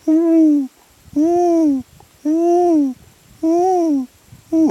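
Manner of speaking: high hummed bird coo; bird call. Retold or imitated. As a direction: hum a high pigeon note, evenly repeated. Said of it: "he go".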